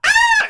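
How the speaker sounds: high, loud scared shriek; mock child's terrified scream; imitated kid scream. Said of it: "they went".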